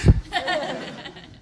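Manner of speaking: airy burst of laughter. Real, not acted. Pitcher laughing at his own startled yelp, airy laugh. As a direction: laugh off the fright.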